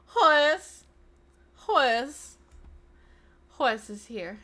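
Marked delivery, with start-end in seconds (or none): 0.1-2.4 loud, high name call with a lift; raised, rising shout of a name; Nicole Byer. Retold or imitated. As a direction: holler the name in greeting. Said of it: "that's how she says it".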